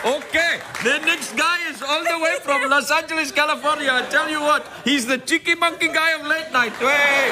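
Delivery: high-pitched club MC with a mock Kosovo accent. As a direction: introduce the act in a dodgy Kosovo accent. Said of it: "that's a good accent".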